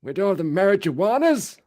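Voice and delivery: put-on high-pitched doddering old man; puzzled old grandpa voice. Mocking old people scolding kids.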